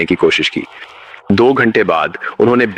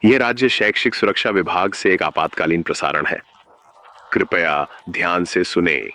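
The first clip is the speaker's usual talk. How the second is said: softer, measured official announcement voice; emergency broadcast; 5 dB quieter than usual. Acted. State education safety department warning students, softer official broadcast. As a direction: read it like a calm, cold emergency alert.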